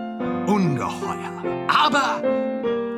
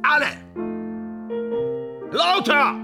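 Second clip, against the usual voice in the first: high, exultant shout over the music; sharp rise in pitch, exuberant; calling out; 10 semitones higher than usual. Acted. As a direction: call out a command to the band.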